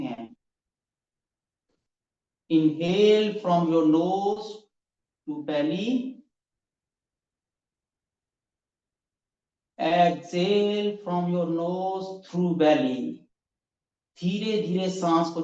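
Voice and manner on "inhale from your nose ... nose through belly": slow, drawn-out breathing instructions; guided breathing. Acted. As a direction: stretch each word like a long breath. (धीमी, लंबी साँस वाली आवाज़)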